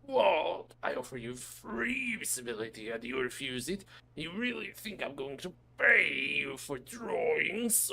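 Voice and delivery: a mild huffy influencer, indignant; a touch of haughty outrage; offended influencer. Acted. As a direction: snub him like a spoiled influencer. Brenny offended at the refusal.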